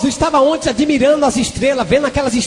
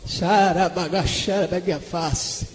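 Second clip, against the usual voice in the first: low, slow, softer glossolalia; speaking in tongues; 6 semitones lower, 7 dB quieter, slower than usual. Real, not acted. Preacher praying in tongues mid-testimony, speaking in tongues. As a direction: drop into slow, hushed prayer syllables.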